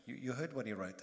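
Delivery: usual speaking voice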